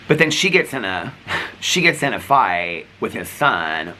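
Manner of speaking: normal talking voice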